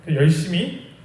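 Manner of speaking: ordinary talk, nothing marked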